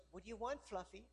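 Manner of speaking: soft, lightly coaxing pet-owner voice; doting dog owner. Acted. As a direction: gently fuss over a little dog. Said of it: "the owner goes".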